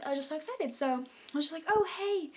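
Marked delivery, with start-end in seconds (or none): 1.7-2.3 faking casual. Retold or imitated. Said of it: "trying not to act so excited".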